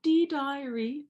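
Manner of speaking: high, slow, sing-song prissy diary voice; hammed-up high, slow diary opening; mock dear diary voice. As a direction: drawl it out like a prim schoolgirl diary. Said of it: "it's not".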